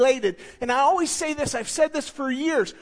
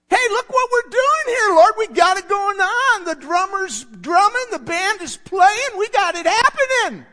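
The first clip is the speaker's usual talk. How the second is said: higher, louder, showing off; mock boastful churchgoer; 7 semitones higher, 6 dB louder than usual. Preacher mocking churchgoers bragging to the Lord, loud. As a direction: brag like a proud churchgoer at the pearly gates.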